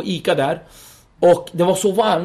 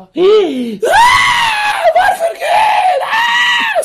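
much higher, louder wailing shout, slow; wailing Kurdish mother; 7 dB louder, much slower than usual. Retold or imitated. Mother at the door seeing police, shrieking to God. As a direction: wail to God as a desperate mother. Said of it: "Skriker som en sån kurdisk morsa".

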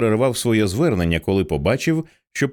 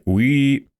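short, higher voiced squeal of feedback; speaker feedback whine; 4 semitones higher than usual. A narrator's reading. Speakers behind them protest, imitated feedback squeal. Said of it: "протестуючи завили динаміки".

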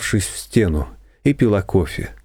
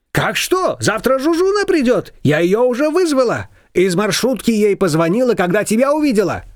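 high, lively neighbour voice; a bossy older neighbour pitched up high; Shushana's voice; 11 semitones higher than usual. A narrator's reading. Neighbour brushing off Nina, high and bossy.

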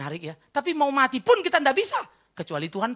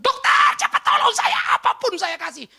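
high shouting plea to the doctor; panicked plea; 11 semitones higher, 8 dB louder than usual. Acted. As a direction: beg the doctor at full voice, panicking. Panicked patient begging doctor.

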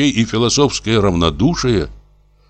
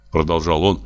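plain reading voice

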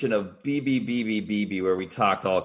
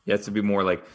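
usual speaking voice

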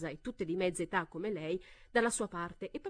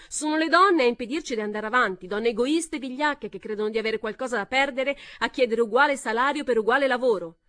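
loud, higher accusing rant; angry shout; 3 semitones higher, 8 dB louder than usual. A narrator's reading. Heckler denouncing selfish women, angry and loud. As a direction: lash out at the cowards. Same woman continues shouting.

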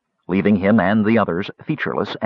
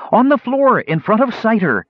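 high, raised, hurried-sounding answer; urgent call; 11 semitones higher, 4 dB louder than usual. Acted. Captive woman to the fighting knight, calling urgently. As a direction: call out where the weapon is.

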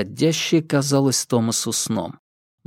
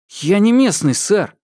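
pleading; 8 semitones higher, 4 dB louder than usual. Acted. Thomas pleads at gunpoint.

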